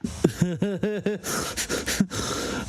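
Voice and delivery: long chuckle, trailing off; real laugh. Real, not acted. Host reacting to co-host's sarcastic summary, amused laugh. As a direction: laugh along at a sarcastic remark.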